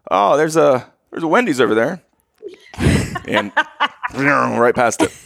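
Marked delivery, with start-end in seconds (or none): 0.1-2.0 casual, offhand pointing out; breezy hint from the back seat; nonchalant hint. Retold or imitated. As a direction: drop the hint lightly, as if in passing. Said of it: "like, nonchalantly".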